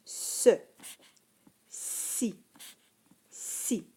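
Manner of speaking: whispered "Ce, si, si" with a hissing S; teacher demonstrating the soft C sound. Shown as a demonstration. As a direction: hiss the soft C in each syllable. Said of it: "Écoute bien".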